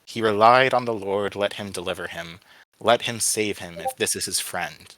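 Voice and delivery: plain reading voice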